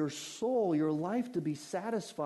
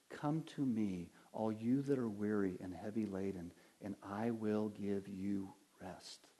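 low, soft voice, slowing at the end; quiet, low-pitched comfort for the burdened; gentle invitation; 7 semitones lower, 6 dB quieter than usual. Retold or imitated. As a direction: tenderly invite the weary to rest. Jesus invites the weary.

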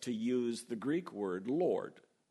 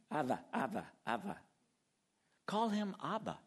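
put-on light childlike babble; baby talk; 3 semitones higher than usual. Preacher mimics a small child calling his father. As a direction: call out like a toddler to his dad. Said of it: "that's baby talk".

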